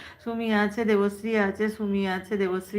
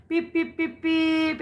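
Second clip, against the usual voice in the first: mock horn beeps; 7 semitones higher, slower than usual. Making way for Jaan bhai.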